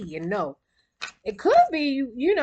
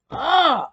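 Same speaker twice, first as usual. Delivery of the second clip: exasperated groan; 7 semitones higher, 9 dB louder than usual. Real, not acted. Exasperated by an annoying man.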